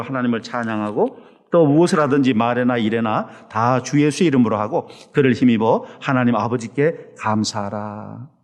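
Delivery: solemn reading of the verse, slowing at the end; scripture reading. Acted. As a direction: read the verses aloud to the congregation. Reads the scripture passage.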